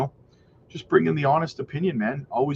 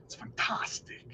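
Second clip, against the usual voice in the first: a breathy, whispered first try at the Nacho Libre voice; a lightly done, whispery first go at the Nacho Libre voice; Nacho Libre impression; 15 dB quieter than usual. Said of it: "Try to do my best Nacho Libre impression".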